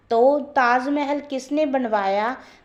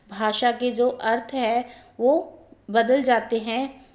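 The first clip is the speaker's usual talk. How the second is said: usual speaking voice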